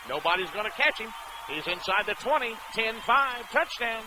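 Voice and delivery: rising excited play-by-play shout; touchdown call. Real, not acted. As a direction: build to a roaring touchdown call. Live touchdown run call.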